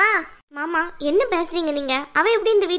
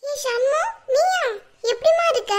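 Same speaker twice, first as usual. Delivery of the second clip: high, startled cry of recognition; surprised greeting; 8 semitones higher than usual. Acted. Sister finding Shanmu at the door, surprised and high. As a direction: gasp out her name, then ask after her.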